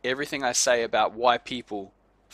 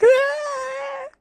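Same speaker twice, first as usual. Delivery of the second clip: shrieking "Ah!" very high and loud; mock scream; 6 dB louder than usual. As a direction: let out a startled shriek. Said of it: "everyone's like".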